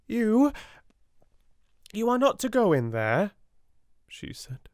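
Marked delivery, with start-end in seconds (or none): 0.1-3.3 higher, louder, fast stammer; awkward woman barring Sarah's door; nervous Miss Amelia. A narrator's reading.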